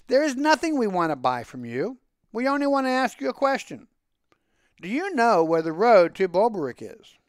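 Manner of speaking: polite impatient lady; city woman. A narrator's reading. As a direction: ask the way politely. Women asking directions.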